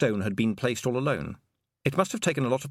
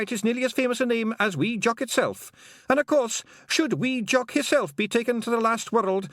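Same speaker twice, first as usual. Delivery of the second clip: high, loud Scots pixie voice, eager to please; a wee Scottish pixie chirping up to Tiffany; Feegle pixie voice; 11 semitones higher, 3 dB louder than usual. Acted. Pixie telling Tiffany his long name, high and eager.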